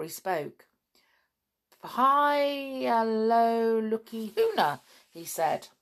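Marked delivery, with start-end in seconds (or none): 1.8-4.8 high, drawn-out spell chanting; magic incantation. A narrator's reading. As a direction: intone the magic words, stretching them out. Sandy chants backwards magic words.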